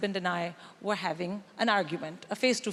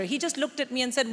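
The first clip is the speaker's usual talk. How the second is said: ordinary talk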